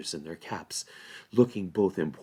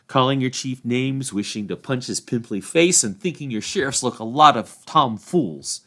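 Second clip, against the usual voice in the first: raised, louder cheeky hobbit; cheeky Sam; 4 semitones higher, 6 dB louder than usual. A narrator's reading. Sam goading the sheriffs, cheeky and loud. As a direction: goad the officials.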